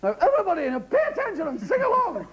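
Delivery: high, bright mock teacher; cheery teacher. Teacher telling the class to sing along, bright and high. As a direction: lead the class in song like a teacher.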